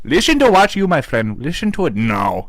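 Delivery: high, exaggerated Indian accent; mock-scold with a thick Indian accent. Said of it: "when he does that accent".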